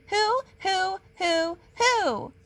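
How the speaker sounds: high spooky hoot; ghostly hooting. A narrator's reading. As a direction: mimic the spooky call. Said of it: "It keeps calling".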